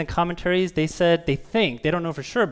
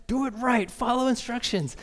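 high-pitched mock coach yell; raised pitch, mock yell without real volume; 8 semitones higher, 3 dB quieter than usual. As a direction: imitate a coach ordering the team around. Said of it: "he starts yelling at us".

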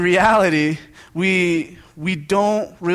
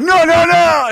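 loud high cry of no no no; alarmed yell; 12 semitones higher, 9 dB louder, slower than usual. Retold or imitated. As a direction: shout to stop the child spilling. Parent yelling at spilling toddler.

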